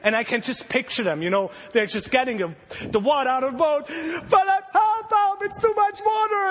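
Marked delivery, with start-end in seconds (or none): 3.9-6.5 high frantic shouting for help; panicked shout. Retold or imitated. Disciple bailing water, yelling to Philip in panic. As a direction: yell for help as the boat floods.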